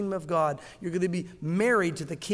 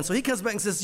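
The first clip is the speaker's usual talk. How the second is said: usual speaking voice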